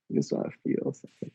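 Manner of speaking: whispered, world-weary cowboy; jaded cowboy whisper. Pretend. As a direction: breathe it like a tired gunslinger. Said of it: "You sound like a jaded cowboy".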